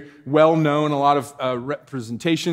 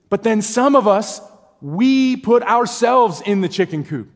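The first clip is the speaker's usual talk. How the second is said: higher, louder, slow and weighty; preacher raising the roof, slowly; preaching force; 7 semitones higher, 6 dB louder than usual. Real, not acted. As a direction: drive the point home hard. Preacher building intensity.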